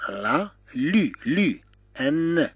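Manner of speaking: "لا لو. لو. ان." slowly, each sound apart; syllable-by-syllable demo. Shown as a demonstration. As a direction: break "لا لو. لو. ان." into separate drawn-out sounds. Teacher breaks word into sounds. (نطق "لا لو. لو. ان." ببطء مقطعًا مقطعًا)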